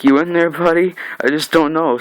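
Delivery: acted sing-out to a dazed victim; mock checking-in. Villain taunts the man he hit.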